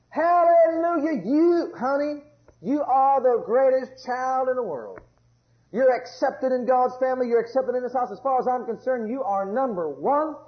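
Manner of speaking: praising parent. Acted. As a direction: lift the child up with delight.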